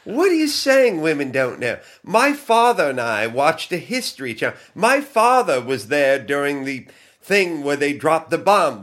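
high fake British accent for Kelly; a hammed-up London girl accent. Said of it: "I'll try to do her fake accent".

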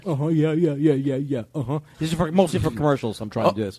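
lazy low uh-huh guy voice. Pretend. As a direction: mumble agreeable uh-huh's. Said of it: "He's the uh-huh guy".

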